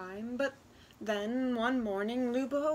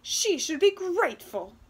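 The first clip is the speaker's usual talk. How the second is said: high, loud indignant mother; mother bellowing at her daughter, loud and high; 7 semitones higher, 9 dB louder than usual. Acted. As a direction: bellow it with outrage. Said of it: "her mother bellowed".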